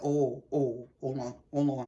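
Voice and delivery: hushed realization over the clip; stunned hold on. Retold or imitated. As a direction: mutter it as it dawns on you. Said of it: "I said".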